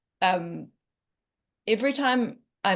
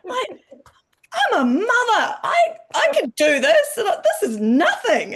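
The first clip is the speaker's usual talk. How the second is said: much higher and louder, slow at first, laughing through it; self pep talk; 12 semitones higher, 7 dB louder, slower than usual. Retold or imitated. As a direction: pump yourself up like a mother who can do anything. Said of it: "in those moments I'm like".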